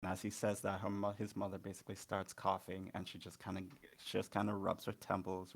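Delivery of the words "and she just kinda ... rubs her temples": ordinary talk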